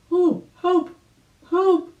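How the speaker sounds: very high, loud cries for help; crying for help. A narrator's reading. Drowning rabbit calls out for help, high and loud. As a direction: shout for rescue in panic. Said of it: "he cried".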